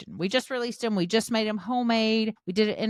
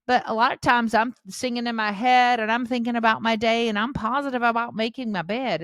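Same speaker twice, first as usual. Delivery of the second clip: normal talking voice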